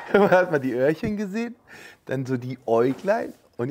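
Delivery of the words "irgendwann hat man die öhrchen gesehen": giggling through it, higher; laughing. Real, not acted. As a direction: repeat it while laughing. Repeats line laughing.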